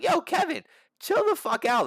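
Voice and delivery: high-pitched mock outburst; heated protest. Fan tells fighter to back off, heated protest. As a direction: push back at someone getting out of line. Retold tense confrontation with fighter.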